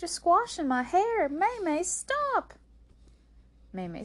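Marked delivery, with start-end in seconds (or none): 0.0-2.5 high, loud protest climbing to a shriek; crying out. A narrator's reading. Rose's hair is being squashed, crying out. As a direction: wail in protest, peaking on the last word. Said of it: "cried Rose".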